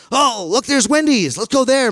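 high, bright excited pointing; eager kid brother. Retold or imitated. Brother points out Wendy's from the back seat, high and eager. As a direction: squeal at the sign like a little boy.

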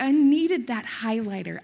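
usual speaking voice

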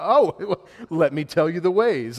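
a spouse gleefully ready to list complaints, laughing; eager grievance list. Pretend. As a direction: pounce on the chance, laughing.

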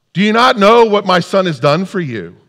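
loud, high, indignant demand; upset outburst. Acted. Grieving parent confronts the indifferent crowd, upset. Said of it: "you get really concerned and upset".

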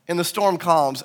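usual speaking voice

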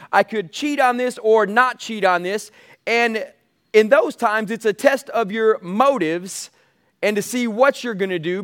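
ordinary talk

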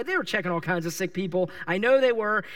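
usual speaking voice

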